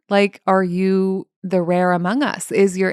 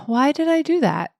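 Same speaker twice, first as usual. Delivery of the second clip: higher-pitched, gently puzzled question; curious self-talk; 8 semitones higher than usual. Shown as a demonstration. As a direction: ask yourself with open curiosity. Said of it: "That's solution focused".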